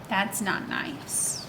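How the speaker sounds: ordinary delivery, nothing marked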